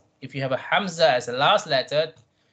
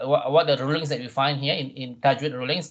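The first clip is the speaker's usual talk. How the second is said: ordinary talk, nothing marked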